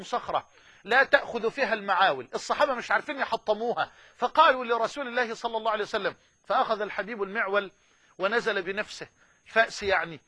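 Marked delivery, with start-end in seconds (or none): none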